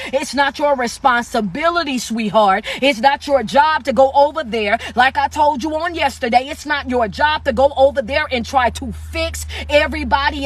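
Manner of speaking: usual speaking voice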